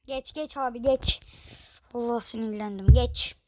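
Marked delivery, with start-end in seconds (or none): none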